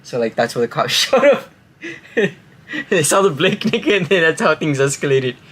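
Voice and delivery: ordinary talk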